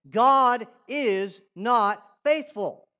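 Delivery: slow, loud, emphatic "God is not faithful."; like you mean it. Shown as a demonstration. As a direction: say it with full conviction, slowly. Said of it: "Say it like you mean it".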